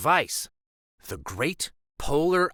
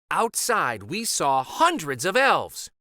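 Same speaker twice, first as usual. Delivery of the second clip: ordinary delivery, nothing marked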